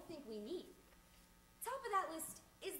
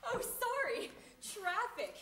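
higher, louder mock apology; latecomer's excuse; 5 semitones higher, 7 dB louder, slower than usual. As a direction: toss out a loud, high-pitched excuse. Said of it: "they always say the same thing".

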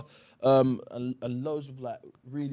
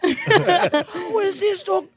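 high and slow, choked with laughter; mock bafflement, cracking up; laughing disbelief; 10 dB louder, much slower than usual. Guest retells reacting to the dog actor, laughing. Quoting own bewilderment, laughing.

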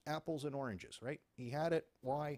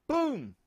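gunshot boom; 13 semitones higher, 14 dB louder than usual. Retold or imitated. Gunshot imitated.

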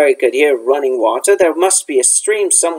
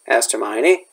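ordinary delivery

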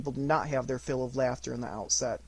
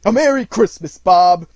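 loud, higher joyful shout; earnest hearty greeting; 6 semitones higher, 16 dB louder than usual. A narrator's reading. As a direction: boom it with total sincerity. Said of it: "with an earnestness that could not be mistaken".

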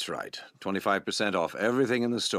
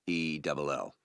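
slow, lower, letter by letter; spelling out letters; 5 semitones lower, slower than usual. Shown as a demonstration. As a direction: spell it out slowly and clearly. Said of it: "W A D D E double L".

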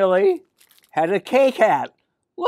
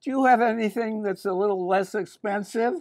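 put-on raised little-girl voice; Millie; 6 semitones higher than usual. Millie asks the shop man for something cheaper, high girlish voice. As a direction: ask it higher, politely, like a girl. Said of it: "she said".

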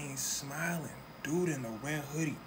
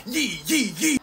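hyped catchphrase; 7 semitones higher, 9 dB louder, slower than usual. Retold or imitated. [name]'s catchphrase on the radio.

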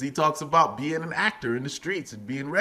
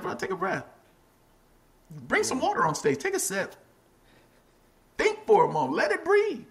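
friendly advice; 12 semitones higher than usual. Retold or imitated. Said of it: "They're like".

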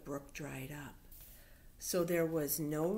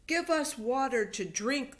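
loud, higher plea for water; demanding crowd; 6 semitones higher, 7 dB louder than usual. Acted. People demanding water from Moses.

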